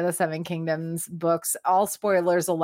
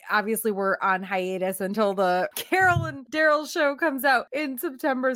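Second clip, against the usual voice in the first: ordinary talk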